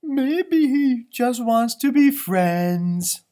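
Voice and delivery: high, slow and sunny puppy; cheerful puppy voice. Acted. Puppy Lucky chirping to Mackenzie about rain. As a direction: bubble over like a happy little dog. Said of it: "Lucky suggested".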